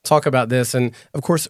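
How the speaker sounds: ordinary talk, nothing marked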